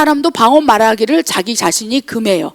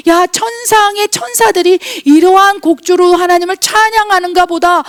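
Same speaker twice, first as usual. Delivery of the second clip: awed exclamation; 6 semitones higher than usual. Acted. Awed reaction to heaven opening.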